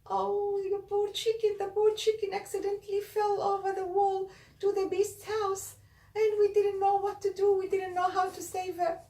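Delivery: high, whiny mock distress; fake sad. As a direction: pretend to be upset, high and pleading. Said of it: "pretending to be sad".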